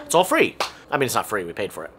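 normal talking voice

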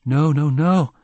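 high, loud desperate pleading; imploring. A narrator's reading. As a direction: beg him to stop, loud and high. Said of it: "she implored".

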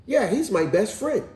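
eager-sounding mock child reply; little boy. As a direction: imitate a boy talking about his friend. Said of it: "And he was like".

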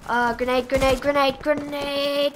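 rising urgent warning calls; grenade warning yell. Real, not acted.

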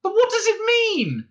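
very high, exasperated cry. A narrator's reading. Arthur demanding an explanation from Ford, crying out. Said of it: "cried Arthur".

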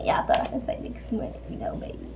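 whispered teasing aside; breathy playful whisper. Real, not acted. Whispered repeat of tease.

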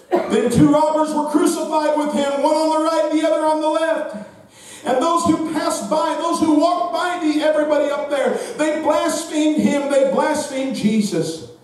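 high, driving scripture reading; intense preached reading. Real, not acted. Preacher reading the crucifixion to the congregation, high and driving. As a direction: preach the verse on a high sustained pitch.

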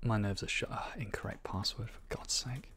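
whispered, nerves shot; frustrated whisper. Real, not acted. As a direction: hiss it out in frustration.

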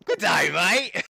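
hammed-up Australian accent greeting; a cheesy Australian accent. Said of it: "pretty sick Aussie accent too".

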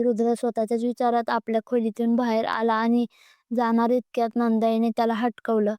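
ordinary delivery, nothing marked

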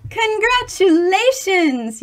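usual speaking voice

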